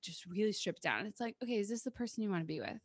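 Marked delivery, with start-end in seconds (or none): 1.4-2.8 soft, quick, simple question; acted calm questioning. Stripping a relationship down to basics, calm questioning. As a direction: ask it quietly, as a simple check.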